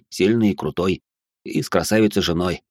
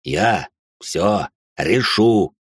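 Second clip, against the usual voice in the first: very slow, each word set apart; emphatic promise; much slower than usual. Acted. Father cutting through his wife's panic, emphatic. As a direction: stretch out Я, ВСЁ, РЕШУ word by word.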